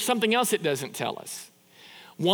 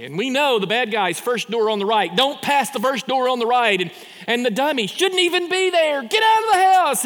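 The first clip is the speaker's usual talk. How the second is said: agitated yelling; 5 semitones higher, 6 dB louder than usual. Acted. Movie watchers warning the dummy in the hallway, building to yelling. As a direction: voice climbing from urgent commentary to a high shout.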